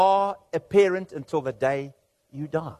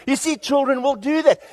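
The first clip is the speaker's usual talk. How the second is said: ordinary delivery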